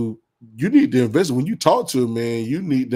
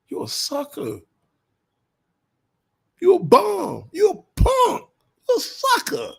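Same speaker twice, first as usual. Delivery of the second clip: high, taunting schoolyard jeers; mock taunting; 10 semitones higher than usual. Taunting a jealous man with mocking high jeers. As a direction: jeer at the man like a playground bully.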